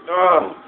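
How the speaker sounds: high, loud grunt of pain; pained groan. Real, not acted. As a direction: cry out as you get hit. Taking a hit in game.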